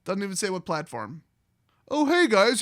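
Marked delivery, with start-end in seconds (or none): 1.9-2.6 higher, louder chirpy greeting; mock cheery announcer. Organizers announcing their new thing, mock cheery. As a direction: put on a peppy promoter's hello.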